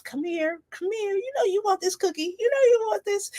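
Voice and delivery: a cookie tempting someone on a diet, coaxing. Pretend. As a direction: lure them like a cookie calling a dieter. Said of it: "and it's just like".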